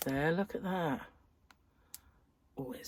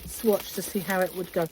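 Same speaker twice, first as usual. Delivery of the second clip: ordinary delivery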